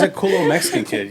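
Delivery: ordinary delivery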